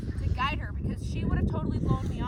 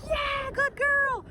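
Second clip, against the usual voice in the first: high, slow, drawn-out praise; excited praise; 5 dB louder, much slower than usual. Shown as a demonstration. Trainer showing praise while grabbing the collar, high and drawn out. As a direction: sweeten it for her. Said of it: "I want it to be like".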